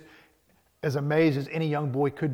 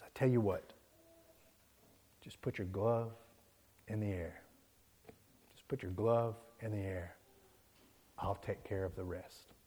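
soft, lower, unhurried coaching; gentle reassurance; 3 semitones lower, 7 dB quieter than usual. Retold or imitated. Benny coaches nervous Smalls in the outfield, reassuring. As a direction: settle a nervous friend quietly.

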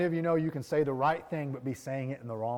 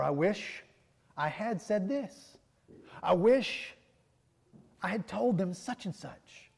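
frustrated regret; 6 semitones higher, slower than usual. Retold or imitated. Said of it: "walked away and thought".

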